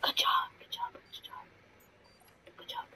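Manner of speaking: hushed praise under the breath; whispering. Real, not acted. Praising a teammate while hiding, whispering. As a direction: whisper it so nobody hears. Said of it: "I don't know why I'm whispering".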